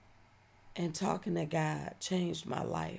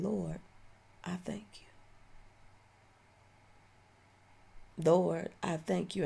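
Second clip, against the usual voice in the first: hushed prayer; 4 dB quieter than usual. Retold or imitated. Quiet prayer after waking.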